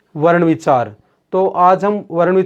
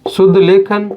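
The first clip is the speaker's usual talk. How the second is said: spaced-out phrases, firmly placed; word-by-word dictation. Real, not acted. Teacher dictating the definition of वर्ण विचार to students, word-by-word dictation. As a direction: dictate in short chunks with long pauses. Dictating words while writing them out.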